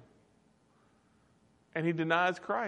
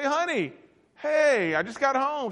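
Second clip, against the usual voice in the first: cheery call; 9 semitones higher than usual. Retold or imitated. Calling wife on phone.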